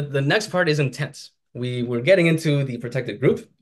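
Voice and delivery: ordinary talk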